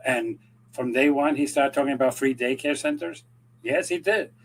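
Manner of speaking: ordinary delivery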